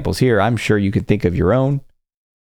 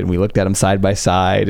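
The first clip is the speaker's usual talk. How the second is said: ordinary talk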